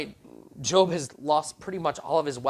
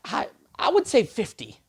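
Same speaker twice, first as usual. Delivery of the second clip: hesitant contestant; 6 semitones higher, 4 dB louder than usual. Retold or imitated. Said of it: "she's like".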